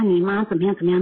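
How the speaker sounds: ordinary talk, nothing marked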